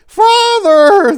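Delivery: put-on very high, loud panicked old-lady screech. A narrator's reading. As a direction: scream his title like she's terrified. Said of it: "the old lady screeched".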